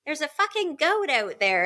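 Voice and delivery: put-on high, loud excited kid shouting about a goat; child with a British accent. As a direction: squeal it like a small boy spotting a goat. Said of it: "and he's like".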